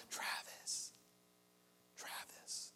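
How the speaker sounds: whispered imitation of a far-off call; faint distant call. Dad calling his lost son from afar, faint whispered call. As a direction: call out the name in a hushed, unvoiced whisper, as if from far away. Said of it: "I hear faint off in the distance, this voice just calling out".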